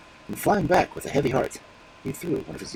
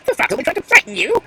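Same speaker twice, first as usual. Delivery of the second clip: much higher and louder, exasperated; scolding crow; 14 semitones higher, 10 dB louder than usual. Acted. Crow scolding the magpie for her foolishness, exasperated. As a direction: lecture her for being fooled.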